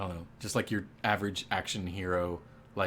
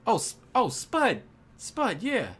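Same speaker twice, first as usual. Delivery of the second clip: higher, eager cat barkeep spotting a regular; excited Tomcat bartender; 6 semitones higher than usual. Acted. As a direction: greet him brightly, pitched way up. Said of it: "he says".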